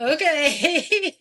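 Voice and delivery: okay broken by real laughter, high and loud; laughing okay. Real, not acted. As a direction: crack up on the word. Cracking up after host laughs.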